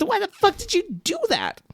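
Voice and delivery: high-pitched, incredulous protest; hammed-up indignant squawk; mock outrage. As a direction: demand an answer, voice shooting way up. Acted outraged reaction to licking.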